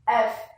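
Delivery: "F" dito "éf", sem vogal final; pronúncia em inglês. Shown as a demonstration. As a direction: diga "F" numa sílaba, à inglesa. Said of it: "E no inglês". ("F" como "ef", em inglês)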